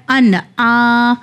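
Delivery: ان آ with a clear, unmerged نون before عین; slow, clear ان آ; izhar, clear nun. Shown as a demonstration. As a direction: say ان آ slowly, keeping the نون distinct. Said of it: "یہاں پہ اظہار کا قانون ہے".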